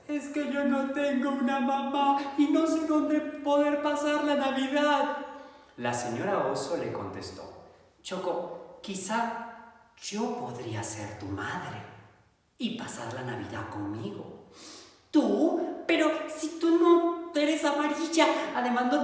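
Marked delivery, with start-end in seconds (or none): 0.1-5.2 high, small plaintive duckling; a sad little duck, pitched well up. Acted. Choco tells the bear she has no mother, high and plaintive. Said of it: "duckling tells bear". 8.0-14.5 gentle bear. Acted. Bear gently offers to be Choco's mother, soft. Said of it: "bear speaks gently".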